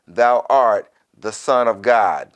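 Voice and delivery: ordinary talk, nothing marked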